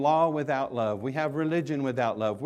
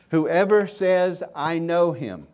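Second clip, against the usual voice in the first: slow, louder, higher emphatic reading of Whoever says; read slowly; 4 semitones higher, 8 dB louder, slower than usual. Shown as a demonstration. Preacher reading First John slowly to congregation, slow and emphatic. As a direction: stretch out each word as if reading scripture aloud. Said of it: "I'm gonna read this slowly".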